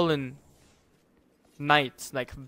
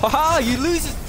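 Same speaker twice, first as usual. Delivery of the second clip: taunting; 10 semitones higher, 8 dB louder than usual. Real, not acted. Taunting opponent in game.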